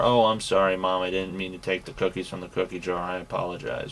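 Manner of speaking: low, quiet, quick mock apology; hammed-up sheepish kid; caught child. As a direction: mumble it like a kid caught stealing, badly faking regret. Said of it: "hand caught in the cookie jar".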